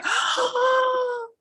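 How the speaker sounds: frightened gasp. A narrator's reading. Frightened children see the fire.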